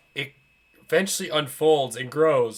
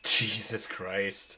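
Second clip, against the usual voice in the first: exasperated groan; 8 semitones lower, 8 dB quieter, much slower than usual. Real, not acted.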